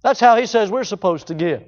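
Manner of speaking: normal talking voice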